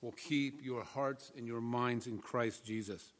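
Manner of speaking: soft, measured reading aloud; scripture reading. Real, not acted. Pastor reading an epistle to the congregation, soft scripture reading. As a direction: read the passage quietly and reverently.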